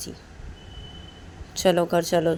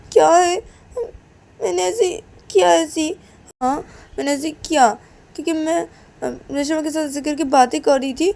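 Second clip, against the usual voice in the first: higher and louder, tearful crying voice; crying child; 6 semitones higher, 3 dB louder than usual. Acted. Kajal tells Reshma's mother why she hurt herself, crying loudly. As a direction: sob out the explanation.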